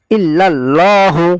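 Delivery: ইল্লাল্লাহু with heavy thick lam, drawn slowly; slow ইল্লাল্লাহু, lam full and heavy. Shown as a demonstration. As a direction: stretch ইল্লাল্লাহু with a dark lam. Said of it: "আল্লাহ শব্দের লামকে মোটা করে পড়তে হয়".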